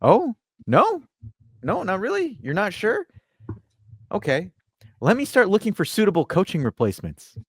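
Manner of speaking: high-pitched mock-innocent questioning; Gentile. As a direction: play an unsure manager stalling for answers. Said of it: "And then Gentile is like".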